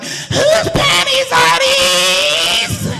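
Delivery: very high, long drawn-out shriek; mock screech. Girlfriend confronts him over found underwear, mock screech. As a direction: stretch every word into an outraged scream. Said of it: "she was like".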